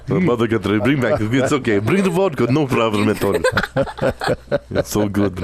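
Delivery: playful mock-Russian accent. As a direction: join in with a joking Russian accent. Host mimics the Russian accent.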